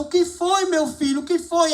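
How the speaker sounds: high, tender motherly voice asking what's wrong; a mother rushing to soothe her crying boy; worried mother. Retold or imitated. Mother comforting crying son. (mãe preocupada com voz aguda e carinhosa)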